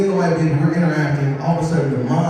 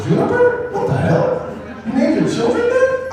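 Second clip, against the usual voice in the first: much higher, disbelieving cry; incredulous squeal. Real, not acted. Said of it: "mock outrage at the name".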